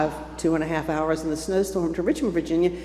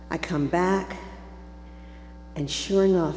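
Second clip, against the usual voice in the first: ordinary delivery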